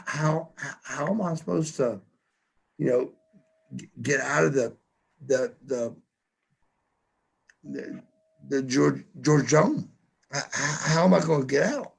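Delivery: retold panicked stammer. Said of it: "I said".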